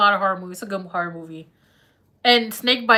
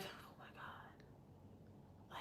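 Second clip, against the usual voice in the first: awed whisper; 37 dB quieter, faster than usual. Retold or imitated. People's awed reaction, whispered.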